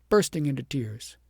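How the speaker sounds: ordinary delivery, nothing marked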